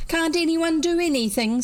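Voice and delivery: high, exasperated cry; frantic boy. A narrator's reading. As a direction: plead from inside the pot. Head stuck in fern pot.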